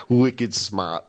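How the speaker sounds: low Boston accent, dropped R; put-on Boston accent. Shown as a demonstration. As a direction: imitate a Boston accent for fun. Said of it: "like, in a Boston accent".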